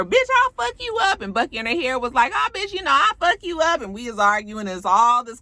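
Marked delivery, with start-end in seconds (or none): 0.0-1.2 high, loud mock yell; Suki. Vlogger imitating castmate yelling at a rival, mock angry shout. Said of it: "Suki is yelling at her". 2.3-3.8 high-pitched mock threat; Bucky. Vlogger imitating castmate answering a rival's threat, high mock anger.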